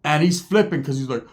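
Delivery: ordinary talk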